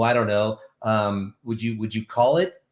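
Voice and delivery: ordinary delivery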